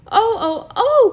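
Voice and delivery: high, loud cries of dismay; anguished cry. A narrator's reading. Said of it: "cried the girls".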